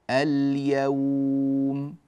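اليوم with ل held before ي; slow, sustained اليوم; clear lam demo. Shown as a demonstration. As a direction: linger on ل, then stretch the end of اليوم. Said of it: "هكذا اللامات ظاهرة هنا".

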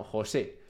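"José" with the stress on the final é; accent on E. Shown as a demonstration. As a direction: stress the last syllable of "José". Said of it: "José con acento en la E".

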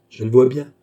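loud, confident reply; assured and emphatic; self-assured. A narrator's reading. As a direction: answer firmly, with aplomb. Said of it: "répliqua Beausire avec aplomb".

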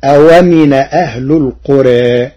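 أومن said with the hamza dropped and its vowel moved to the waw; Warsh reading. Shown as a demonstration. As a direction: read أومن as awamin. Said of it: "صارت". (أومن بنقل حركة الهمز)

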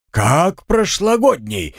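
high, slow indignant outcry. A narrator's reading. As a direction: sound offended and outraged. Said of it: "возмущался медовик".

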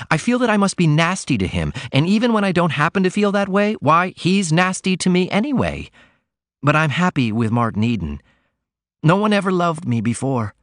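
put-on shy young daughter pouring out her worries; young woman Ruth. A narrator's reading. Ruth confides in her mother.

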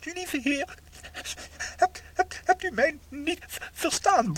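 high, loud stammering appeal; stuttering panicked prayer; frantic plea. Acted. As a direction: beg the Lord in alarm. Pleading to God in panic.

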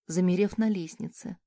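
ordinary delivery, nothing marked

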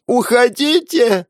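very high, loud wail; wailing woman. A narrator's reading. The hostess wails at the police, her son at gunpoint. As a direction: wail it in terror. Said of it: "заголосила женщина".

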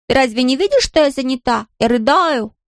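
high mock-wailing nanny; child's hammed-up nanny impression; imitating nannies. A narrator's reading. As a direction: mimic a distraught nanny, high and exaggerated. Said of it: "принялся подражать голосам нянь".

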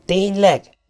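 sarcastic, rising mock surprise; mock him with fake surprise. Powell mocks Donovan's obvious remark, sarcastic. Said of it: "gúnyolódott Powell".

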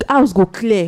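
normal talking voice

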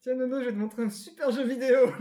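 high, loud teasing big-brother voice; imitating the big brother. Grand frère imité qui appâte. (voix de grand frère taquin, plus aiguë et forte)

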